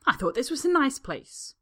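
high, loud indignant complaint. Acted. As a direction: complain in outrage. Woman's tirade.

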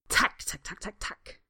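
whispered clicking tacks; beetle clicks. A narrator's reading. Beetle Stanley clicking happily in Draco's arms. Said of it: "clicks Stanley".

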